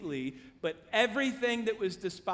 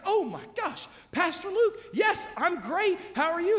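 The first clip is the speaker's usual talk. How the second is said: high, gushing imitation; hammed-up eager greeting; starstruck delight; 10 semitones higher, 5 dB louder than usual. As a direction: act thrilled to hear from someone admired. Imitates man delighted by pastor's call.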